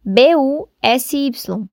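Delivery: letters spelled slowly; slow spelling. Shown as a demonstration. (soletrando devagar)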